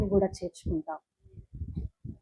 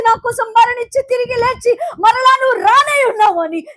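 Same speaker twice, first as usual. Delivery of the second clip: loud, raised preaching; preaching at full force; 10 semitones higher, 8 dB louder than usual. Acted. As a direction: drive the promise home to the crowd.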